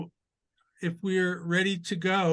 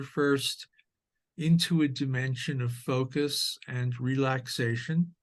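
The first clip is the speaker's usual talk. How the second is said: measured meditation guide with long pauses; guided meditation. Acted. Teacher leading sitters into awareness of the breath, guided meditation voice. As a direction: guide listeners gently through posture and breath, unhurried.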